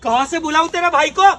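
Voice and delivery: loud, high angry jeer; contemptuous shouting. Acted. Man insulting woman's absent brother, contemptuous shouting. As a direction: yell scornfully at the victim.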